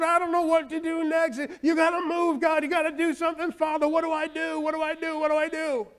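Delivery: high, loud pleading, repeated desperately; crying out. Retold or imitated. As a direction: beg God out loud for help. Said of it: "He's, he's crying out and said".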